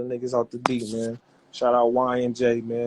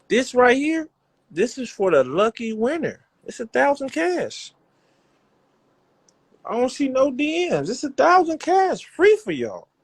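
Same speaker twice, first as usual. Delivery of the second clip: much higher, pumped-up giveaway pitch; showing off the cash prize, voice jumping high with excitement; excited hype; 10 semitones higher, 3 dB louder than usual. Real, not acted. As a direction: sell the thousand cash like it's the best deal going.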